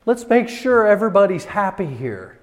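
acted eager-to-please ruler; people-pleasing Pilate. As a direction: placate everyone, pitched well up. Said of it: "is the idea".

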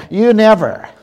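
loud, high mock accusation; accusing wife. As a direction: throw it out as an acted spousal complaint. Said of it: "When your wife looks at you and says".

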